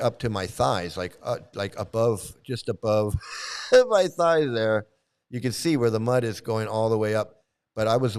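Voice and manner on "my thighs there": louder and higher through a laugh. Real, not acted. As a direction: burst of real laughter into the words. Laughs at own mud story.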